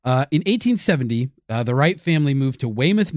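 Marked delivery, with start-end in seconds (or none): none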